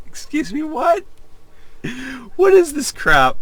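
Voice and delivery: can't believe what I'm reading; squeaky bewildered what; incredulous. Real, not acted. Baffled reaction to silly text.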